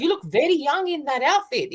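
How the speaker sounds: a hint of an Indian man's accent, voice lifted up. Fan complimenting her outfit after the show, light Indian accent. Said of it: "with his little accent".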